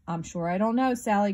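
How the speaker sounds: ordinary delivery, nothing marked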